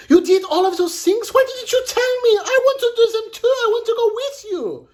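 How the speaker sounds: very high, loud, rushed pleading; excited cry. A narrator's reading. Mirette begs Bellini to take her along, excited. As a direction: gush it out loud, high and fast. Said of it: "she cried".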